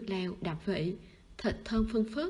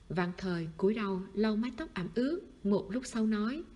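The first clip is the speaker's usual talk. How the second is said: plain reading voice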